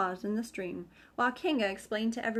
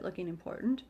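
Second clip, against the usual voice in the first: ordinary delivery, nothing marked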